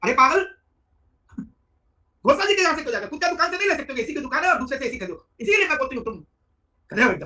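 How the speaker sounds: wife yelling at her husband for buying ACs elsewhere; furious wife. Retold or imitated. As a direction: call him a fool at full volume. Wife scolding husband on phone.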